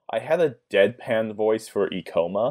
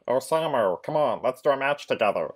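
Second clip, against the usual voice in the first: higher-pitched cartoon alien voice; Stitch; 4 semitones higher than usual. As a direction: put on a high little creature voice. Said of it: "but in the Stitch voice".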